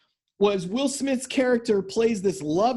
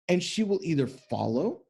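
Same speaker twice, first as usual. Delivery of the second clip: usual speaking voice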